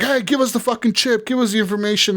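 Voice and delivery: high, pushy mock ninja demand. Mock ninja demanding the chip from V, high-pitched. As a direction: bark the order up high like a cyborg ninja.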